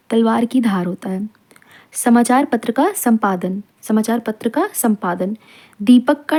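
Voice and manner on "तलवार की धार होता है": whispered. Shown as a demonstration. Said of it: "teacher repeats phrase for class".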